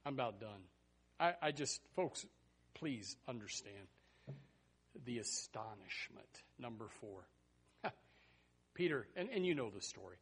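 ordinary talk, nothing marked

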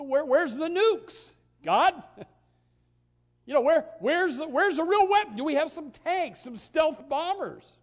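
mock incredulous outcry. Mock complaint to God.

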